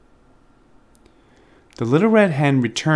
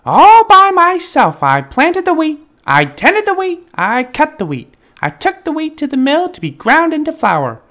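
proud indignant hen; 13 semitones higher, 7 dB louder than usual. A narrator's reading. The hen refusing to share the cake, high and proud. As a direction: list all her work with righteous pride. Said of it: "But the little red hen said".

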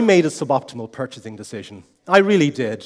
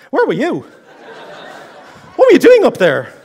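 wife demanding; 10 semitones higher, 5 dB louder than usual. Retold or imitated.

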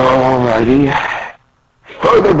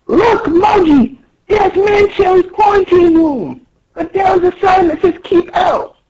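very high shouted cartoon voice; high character voice. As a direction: squeak it out high.

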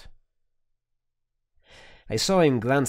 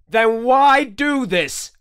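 slow, loud, raised demand; 13 semitones higher, 7 dB louder, slower than usual. Acted. Interrogator demands the prisoner explain, slow and forceful. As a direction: drag the question out hard. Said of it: "I demanded".

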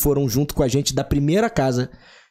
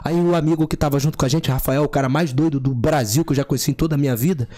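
normal talking voice

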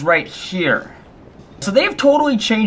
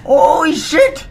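panicked screaming. Real, not acted. Player screaming at the game during a chainsaw attack, panicked shrieks. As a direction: scream in terror as a chainsaw swings at you.

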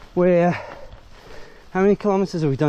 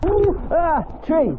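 surprised; 13 semitones higher, slower than usual. Real, not acted. Riding into a tree on a trail.

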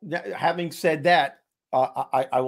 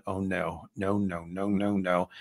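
holding back tears; 6 semitones lower, 5 dB quieter, slower than usual. Retold or imitated. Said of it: "I was crying".

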